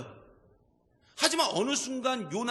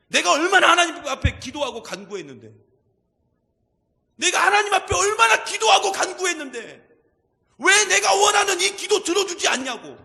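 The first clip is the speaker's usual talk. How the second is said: much higher, much louder shouted prayer; angry demanding prayer; 9 semitones higher, 11 dB louder than usual. Retold or imitated. A believer shouting threats at God in prayer, acted rage. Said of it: "막 협박을 하면서 하나님 앞에 기도하시는".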